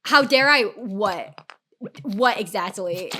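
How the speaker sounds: slow, loud-starting mock disbelief; indignant comeback. Streamer retells answering the dude's accusation, indignant. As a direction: throw the accusation back, drawn out.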